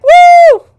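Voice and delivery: very high, loud celebratory "woo"; piercingly high cheer, imitating excitement; imitated excited whoop. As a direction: let out a mock-thrilled whoop. Retold cheer at the news.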